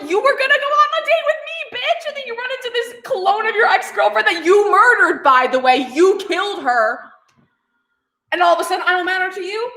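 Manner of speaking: high, hurt whiny mock Oswald. Oswald scolding Ed for choosing the clone, high and whiny. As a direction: whine it like a jilted lover. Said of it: "Oswald's like".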